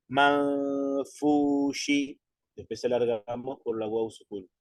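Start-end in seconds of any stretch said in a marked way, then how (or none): 0.1-2.2 "Manfushi" very slow, with the nun hummed nasally; nasalized recitation. Shown as a demonstration. Teacher showing the class the nasalization before the fa, slow. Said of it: "entonces nasalizamos".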